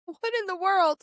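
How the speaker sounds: high, stunned "what in the world"; incredulous outburst; disbelieving. Retold or imitated. Patient reeling at the cold news, high and disbelieving. Shocked at doctor's coldness.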